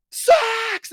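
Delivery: very high-pitched mock scream; imitated screaming. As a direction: shriek it like an unhinged streamer clip. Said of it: "just screaming as loud as she could".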